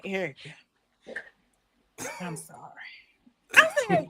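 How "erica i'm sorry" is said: imitating dad in a low, near-whispered confession; low and very quiet, with a long pause; hushed apology. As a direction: drop to a soft, halting whisper for dad's apology. Retold dad confessing to daughter.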